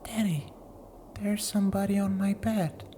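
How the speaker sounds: put-on high, hushed frightened child; child whisper. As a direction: whisper it like a scared kid. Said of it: "and whispering".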